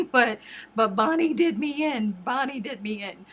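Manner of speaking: real laughing. Real, not acted. Streamer admitting to viewers she cried, laughing. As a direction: confess it through a laugh.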